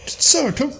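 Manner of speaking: high, urgent servant voice; lightly voiced minstrel beckoning; minstrel calling. The minstrel calling Lancelot to escape, high urgent call. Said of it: "And he's like".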